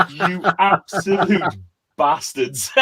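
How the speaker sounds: drawn out slowly through laughter; laughing mock outrage. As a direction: laugh out a mock insult at the barman. Mock outrage at hidden wine glasses.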